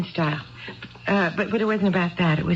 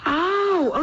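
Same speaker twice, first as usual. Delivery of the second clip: surprised cry; 11 semitones higher than usual. Acted. Wife's sudden cry of realization.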